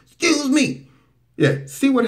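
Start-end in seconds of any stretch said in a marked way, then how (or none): 0.1-0.9 high, loud mock interruption; mock yelling out. Commentator imitates someone yelling at the judge, high and loud. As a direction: shout it out of turn from the back. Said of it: "Who's that yelling back there?".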